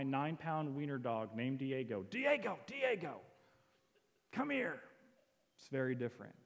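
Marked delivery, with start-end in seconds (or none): 2.0-4.8 high-pitched mock scolding of a dog; imitated yelling. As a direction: call the dog sharply, pitch jumping up. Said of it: "God's not yelling at him".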